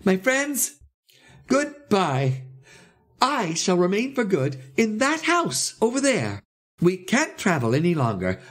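high, emphatic farewell announcement; excited high proclamation; crying out. A narrator's reading. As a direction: declare it high and deliberately, word by word. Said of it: "he cried".

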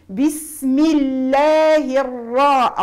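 tajweed model reading; slow recitation. Shown as a demonstration. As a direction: recite deliberately. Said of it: "الراء مفخمة".

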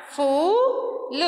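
फूल with a long drawn ऊ; teacher showing the long vowel; long oo sound. Shown as a demonstration. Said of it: "बड़ा ऊ की मात्रा".

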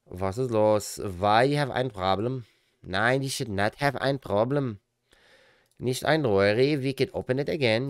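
joking fake German accent, German words mixed in; mock German accent. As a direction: play a cheery German mechanic with a fake German accent.

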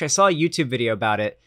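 ordinary delivery

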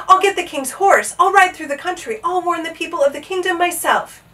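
high, loud determined exclaiming; urgent resolve. A narrator's reading. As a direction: declare a plan at full urgency. Resolving urgently to raise the alarm.

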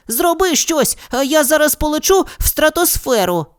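put-on high, pleading boy's voice; a hint of panic; panicking. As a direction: beg her for help in a fright. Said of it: "запанікував Женя".

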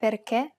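"Perché" ending in a stressed closed e; careful teaching "Perché" with closed last vowel. Shown as a demonstration. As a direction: keep the final é of "Perché" closed. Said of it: "pronounce it as a closed E".

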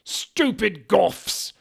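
high, loud, very slow scornful shout; angry yell. A narrator's reading. Headmaster yelling insult at goth students. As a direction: spit it out at them.

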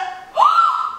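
sudden high gasp of alarm; startled gasp; sharp gasp. Real, not acted. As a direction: gasp in shock. Discovers door open.

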